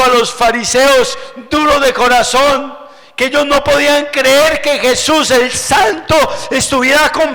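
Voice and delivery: predicador gritando, más agudo y fuerte; predicación a todo pulmón. Acted. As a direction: clama el perdón de Dios a la congregación. Predicación a toda voz. (sermón a grito encendido)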